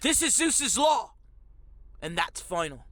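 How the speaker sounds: hammed-up ancient Greek film accent; mock epic accent. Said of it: "they'll be like this".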